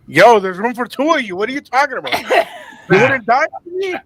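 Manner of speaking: playful incredulous man arguing; teasing protest about the door; mock Titanic complaint. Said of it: "you're watching Titanic".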